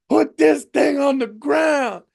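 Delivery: high, loud, slow mock demand; frightened passenger. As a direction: holler it like an alarmed passenger. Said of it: "He's going".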